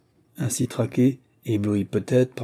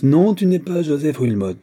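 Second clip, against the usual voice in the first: higher, louder exclamation; triumphant cry; 6 semitones higher, 6 dB louder than usual. A narrator's reading. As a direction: throw it at him in triumph. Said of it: "d'un ton de triomphe".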